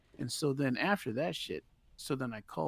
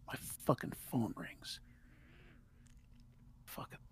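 whispering; 3 semitones higher, 7 dB quieter than usual. Real, not acted. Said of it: "I have to whisper".

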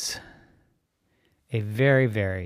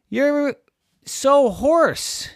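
high, loud teasing sister; little sister voice; 11 dB louder, much slower than usual. Acted. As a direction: squeak it out like a bossy big sister.

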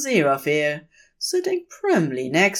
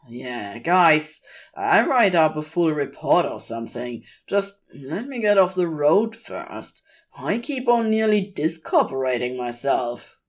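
usual speaking voice

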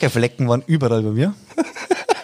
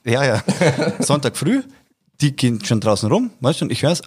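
ordinary talk

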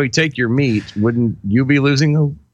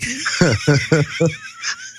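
high giggle; 11 semitones higher than usual. Real, not acted. Laugh after in-the-know joke.

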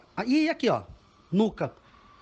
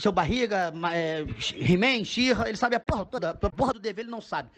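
ordinary talk

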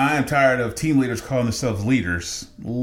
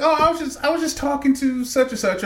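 much higher, sugary mock apology; mocking team lead. Team lead apologizing for the late reply, mock sweet high voice. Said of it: "And she's like".

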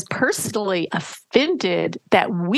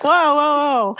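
louder, higher calming whoa; playful louder whoa, pitched up; mock whoa; 5 semitones higher, 4 dB louder than usual. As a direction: rein it in with a raised whoa. Calming an imagined reaction.

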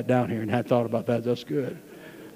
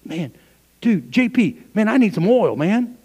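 higher, mock panicky guest; foolish virgin caught short; 7 semitones higher than usual. Pastor mocking the unready guest, hammed-up panic. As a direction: play the one who ran out of oil. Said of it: "Or will you be going".

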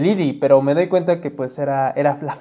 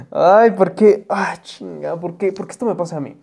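usual speaking voice

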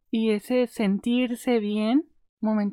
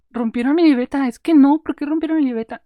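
loud, higher, indignant yelling; furious outburst; 5 semitones higher, 8 dB louder than usual. Acted. Acting out her rage over the ripped notebook, shouting. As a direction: blow up about the torn notebook. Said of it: "estoy súper encabronada".

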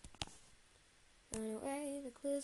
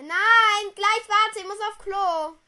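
high, loud call to another room; 8 semitones higher, 20 dB louder, much slower than usual. Real, not acted. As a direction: shout toward another room. Calling out again.

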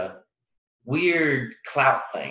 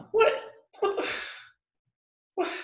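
stunned; 3 dB quieter than usual. Real, not acted. Reacting to Baylor unbeaten.

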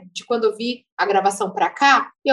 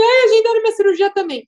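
high, louder, half-crying joy; retold tearful excitement; 8 semitones higher, 5 dB louder than usual. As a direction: blurt out the happy news through tears. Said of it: "eu comecei a chorar".

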